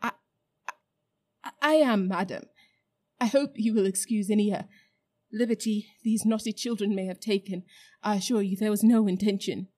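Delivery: stammering fear. Acted. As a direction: stumble over every word in fright. Uncle Andrew stammering to the Queen.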